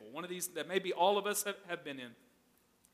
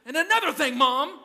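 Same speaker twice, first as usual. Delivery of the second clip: higher, louder hammed-up retort; playful mock comeback to Mom; mock argument; 7 semitones higher, 6 dB louder than usual. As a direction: win the pretend fight with Mom. Imagined winning fight with mom.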